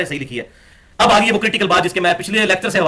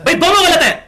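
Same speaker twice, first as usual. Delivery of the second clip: sharp, higher, louder cry of disbelief; exasperated outburst; 11 semitones higher, 6 dB louder than usual. Real, not acted. Lecturer telling the audience that both sides are wrong, exasperated. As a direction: throw it out like an exasperated brother. Lecturer exclaims at the absurd excuse.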